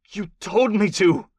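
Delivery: high, drawn-out, bewildered answer; confused warlord. A narrator's reading. Captive answers his interrogator, confused. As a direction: answer slowly, baffled, in accented character voice. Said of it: "said in what seemed like confusion".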